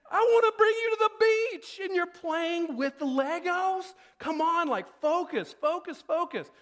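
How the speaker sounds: exasperated, begging them to focus; frustrated dad. Retold or imitated. Dad exasperated at Nora playing Legos, high frustrated plea. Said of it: "this really drives me nuts".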